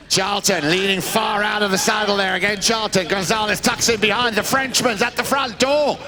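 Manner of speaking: live play-by-play. Real, not acted. Commentator calls the pass going through, rising excited play-by-play. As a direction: build the attack into an excited, stretched cry.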